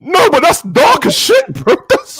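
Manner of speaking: slow, high laughing protest; laughing disbelief. Real, not acted.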